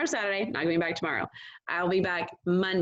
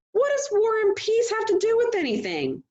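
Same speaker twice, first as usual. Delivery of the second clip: much higher, louder exasperated challenge; annoyed mother challenging her husband; 4 dB louder than usual. A narrator's reading. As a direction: snap the question back in annoyance. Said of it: "clearly annoyed".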